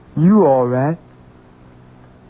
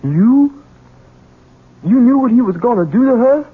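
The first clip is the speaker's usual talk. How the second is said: a louder, higher horrified accusation; shocked outburst; 4 semitones higher, 4 dB louder than usual. Acted. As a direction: cry out in disbelief at your mother. Son confronts mother in horror.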